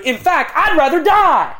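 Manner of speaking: an apostle swearing he'd sooner die; intense declaration. Shown as a demonstration. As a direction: hit it with fierce conviction. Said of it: "That is serious language".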